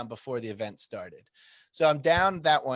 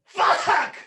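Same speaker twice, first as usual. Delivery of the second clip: sudden high shout of frustration; real yell; 10 dB louder than usual. Real, not acted. After a sneeze, a sudden loud high curse.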